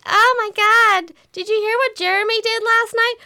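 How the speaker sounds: high gossipy American tourist voice; American tourist impression. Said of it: "an accurate rendition of what I heard".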